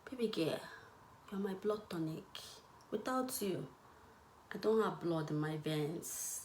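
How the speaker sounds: hushed, intimate lover's whisper; a man whispering sweet nothings to his girl; whispered sweet talk. Retold or imitated. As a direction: breathe it to her softly.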